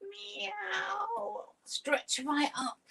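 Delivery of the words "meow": very high, soft meow; a dainty kitten meow. Pretend. Teacher leading cat stretches, very high soft meow. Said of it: "pretend to be a cat".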